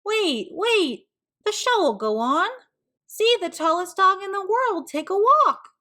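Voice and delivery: loud, high, pleading showman call; shouting. Acted. Archie shouts at the leaving crowd, high and loud. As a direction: shout to stop people leaving. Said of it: "Archie shouted".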